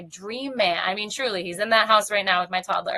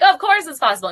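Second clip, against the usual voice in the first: higher, louder mock-confident quote; imitated breezy certainty; 7 semitones higher, 9 dB louder than usual. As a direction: voice someone finding it obvious. Voicing people who find it obvious.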